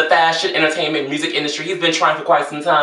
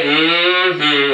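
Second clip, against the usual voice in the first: knowing movie-style mm-hmm; a sly knowing hum; poetic justice voice; 3 semitones lower than usual. Acted. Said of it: "in my poetic justice voice".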